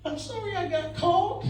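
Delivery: very high, falsetto fake apology rising at the end; hammed-up falsetto sorry from a ticketed offender; mock apology. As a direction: squeak out a fake insincere sorry.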